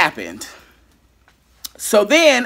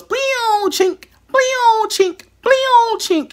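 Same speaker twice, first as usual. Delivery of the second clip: imitated computer noises; much slower than usual. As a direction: mimic a dying computer chiming. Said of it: "it goes".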